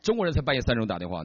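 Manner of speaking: ordinary talk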